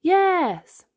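joyful family cheer. Acted. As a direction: cheer it out together. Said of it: "cheer Daddy Pig".

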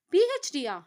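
"B H Diya?" asked high and loud, stress on H, puzzled; a baffled "B H Diya?", voice jumping up; confused. A narrator's reading. Tharuni, puzzled by Vathani's news, asks "B H Diya?" confused. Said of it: "என குழப்பத்துடன் கேட்க".

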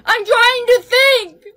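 high, slow, strained protest; panicked protest. Acted. Brianna pressed by her friend to hurry, panicked protest.

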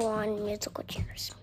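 slow hushed whisper. Real, not acted. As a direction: whisper the result softly. Quiet aside during game.

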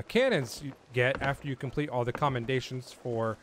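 normal talking voice

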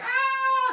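sudden shrill falling scream; high loud yelp. Pretend. Baby thrown.

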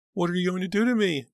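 very high, loud frightened cry; voice filled with terror. A narrator's reading. Pam pleading with her captor, terrified cry. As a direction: repeat it in high-pitched terror. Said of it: "her voice so filled with terror".

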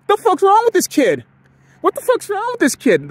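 high, loud mock outrage; pissed-off kids. Other kids griping about the boy who runs fewer laps, pissed off. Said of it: "other kids would clearly get pissed".

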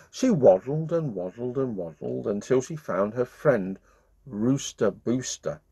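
ordinary delivery, nothing marked

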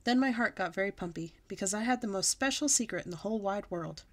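usual speaking voice

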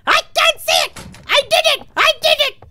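very high, loud witchy squeal; witch-like screech. Real, not acted. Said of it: "I sound like a witch".

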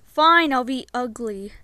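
ordinary talk, nothing marked

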